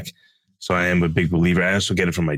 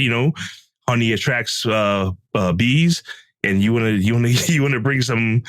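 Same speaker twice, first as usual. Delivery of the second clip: ordinary talk, nothing marked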